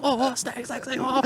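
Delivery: high, panicked cries of snake; panicked tourists. Retold or imitated. Tourists at a barbecue panicking, high frightened cries. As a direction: tourists shrieking as the snake falls. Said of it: "They're like".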